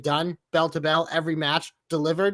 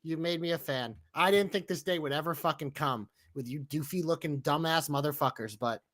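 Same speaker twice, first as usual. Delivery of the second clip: ordinary talk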